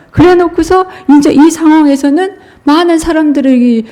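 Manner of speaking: high, animated mocking telling; hammed-up disciples boasting; mock-excited high voice. Preacher mocks the proud disciples. (들뜬 조롱조의 높은 목소리)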